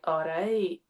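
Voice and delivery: a gruff Scottish greeting, toned down; Scottish accent. Retold or imitated. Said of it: "Whereas in Scotland, it's like".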